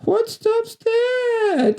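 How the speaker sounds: put-on very high, hugely drawn-out child question; mock kid's voice. Dubbed boy challenging the girl at the game, mock kid's voice. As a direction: stretch it out in a squeaky fake little-boy voice. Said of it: "an adult doing a kid's voice".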